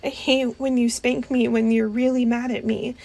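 ordinary talk

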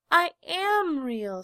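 high, slow, loud plea; insist, near tears; tearful insistence. A narrator's reading. Little rabbit insists he is real, near tears. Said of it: "said the little rabbit".

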